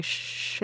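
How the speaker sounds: soft, voiceless shush. Acted. Podcaster as Poe cutting off the droid, shushing. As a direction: hush a chattering companion.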